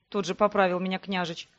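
plain reading voice

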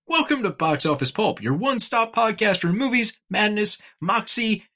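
bright host greeting; energetic show opener; upbeat welcome. Shown as a demonstration. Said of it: "upbeat redo of greeting".

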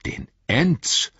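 high, rising echo question; astonished cry. A narrator's reading. Aragorn hears of the Ents, crying out astonished. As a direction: repeat it in amazement, pitch leaping up. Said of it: "rief Aragorn".

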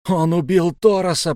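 loud, high accusation; insistent repeat. A narrator's reading. Boy repeating his charge against the killer, insistent. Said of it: "повторил я".

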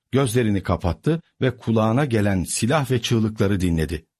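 usual speaking voice

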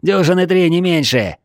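high, loud answering call; lookout's shout. A narrator's reading. Lookout answers the men below, high loud shout. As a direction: shout the count down to them.

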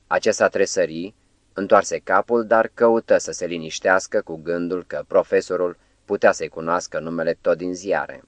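ordinary delivery, nothing marked

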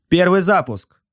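high, loud crew shout; high-pitched battle cry of a gunner; shouted launch call. A narrator's reading. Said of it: "крикнул раб войны".

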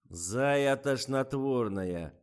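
slow, stony, through the teeth. A narrator's reading. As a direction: grind it out like a stone statue. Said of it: "каменным голосом процедил Николай".

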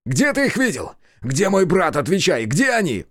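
high, urgent angry questioning; shouted demand. A narrator's reading. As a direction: demand answers right in his face. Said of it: "закричал ему прямо в лицо".

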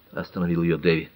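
ordinary delivery, nothing marked

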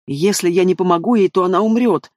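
ordinary delivery

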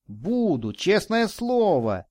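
high, drawn-out happy promise; joyful exclamation. A narrator's reading. Boy promising the man he will behave, joyful. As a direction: promise it like an overjoyed boy, pitch leaping up. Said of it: "радостно воскликнул мальчик".

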